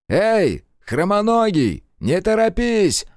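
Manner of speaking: loud, high, drawn-out hail across the road; calling out. Acted. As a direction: shout after him, stretching the words. Calls out to a fleeing man. (громкий протяжный оклик вдогонку)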